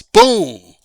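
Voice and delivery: deep, loud booming "Boom"; booming mock impact. Host landing his point with a deep boom. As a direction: drop it like a bass hit. Punchline sound effect word.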